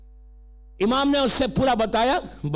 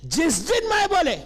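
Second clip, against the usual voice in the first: forceful sermon delivery; preaching; 7 semitones higher than usual. Acted. Preacher warning the congregation that ego is a disease, preaching.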